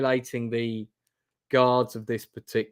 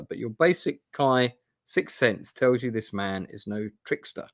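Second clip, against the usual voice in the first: usual speaking voice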